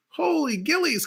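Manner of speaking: high, astonished exclamation; amazed. Real, not acted. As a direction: gasp it out in amazement. Reacting to a huge save.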